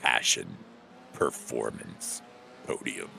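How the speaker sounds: very deep, slow announcer voice; radio voice. Shown as a demonstration. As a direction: drop way down low and pause after each word. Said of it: "in your radio voice".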